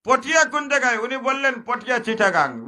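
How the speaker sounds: high, loud questioning; preacher voicing the stranger's question; loud preaching. (চড়া গলায় প্রশ্ন)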